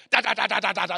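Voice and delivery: rapid-fire "da" heartbeat noise; loud drumming pulse imitation; imitated racing heartbeat. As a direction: hammer out a panicked heartbeat. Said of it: "it's just beating".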